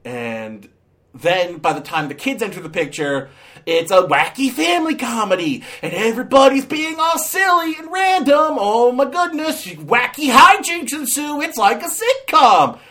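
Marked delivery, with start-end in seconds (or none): 3.7-12.8 high, hammed-up sitcom excitement; mock zany enthusiasm. Reviewer mocking the kids' arrival in the film, fake zany glee. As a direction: squeal it like an over-the-top wacky promo. Mocking sudden shift to wacky comedy.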